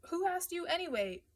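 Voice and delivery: high-pitched, irritated challenge; hostile snub. A narrator's reading. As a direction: pitch it up and put the speaker down. Matthew snubs Rossi.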